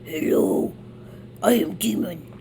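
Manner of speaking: growly mock demon. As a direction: do a silly demon. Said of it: "Wait, I'm gonna actually try".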